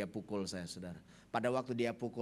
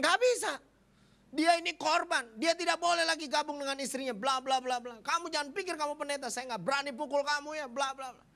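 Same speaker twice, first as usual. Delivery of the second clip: very high, loud imitation of a furious rant; retold angry refusal; 13 semitones higher, 5 dB louder than usual. Brother refuses and threatens pastor, acted furious shouting. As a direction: shout him down in a mock furious tirade. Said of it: "Kenapa kamu harus marah-marah".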